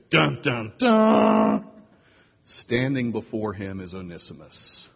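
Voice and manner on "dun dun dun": loud sung dun dun DUN, last one high; mock dramatic dun dun dun; dramatic music sting. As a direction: belt out a movie-music sting. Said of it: "Cue dramatic music".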